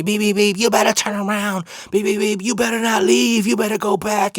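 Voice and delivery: mild mock yell of angry witnesses; mocking bystanders. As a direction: imitate nagging drivers honking. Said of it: "All these people yelling at me".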